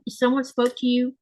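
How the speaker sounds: ordinary talk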